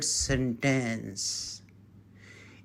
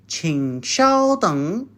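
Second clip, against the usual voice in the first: 请稍等 said slowly and clearly; slow model phrase. Shown as a demonstration. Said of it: "means wait for a while".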